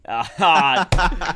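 ordinary delivery

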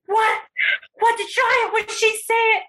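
imitating the girl. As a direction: shriek it like the giggling classmate.

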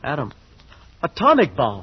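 can't believe what he heard; alarmed double take; shocked disbelief. Acted. Shocked at the bomb news.

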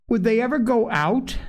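put-on high-pitched little girl's question; grave child. A narrator's reading. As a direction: ask earnestly in a small child's high voice. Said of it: "asked the child gravely".

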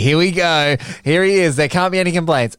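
high, eager showman buildup; hyped-up host. Pretend. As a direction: sell it like a game-show host. Host hyping his own setup.

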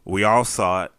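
ordinary talk, nothing marked

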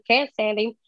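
ordinary delivery

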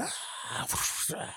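thin breathy scream, no voice behind it; imitating a far-off cry in the night; mock distant scream. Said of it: "You just hear screams".

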